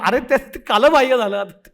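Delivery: ordinary talk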